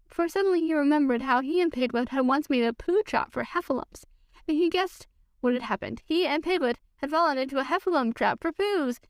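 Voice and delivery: ordinary delivery, nothing marked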